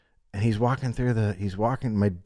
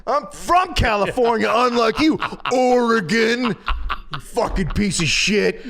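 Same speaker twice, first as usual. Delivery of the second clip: high, louder hammed-up boast; mock proud Californian; 12 semitones higher, 4 dB louder than usual. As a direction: lord it over Oregon, laughing. Mocking a club member's pride.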